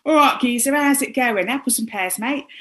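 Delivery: hammed-up Cockney geezer; Cockney accent. Guest showing how Cockney London sounds, in a put-on Cockney accent. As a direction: greet a mate in broad London Cockney. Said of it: "London was also split into Cockney".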